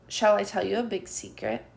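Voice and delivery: ordinary delivery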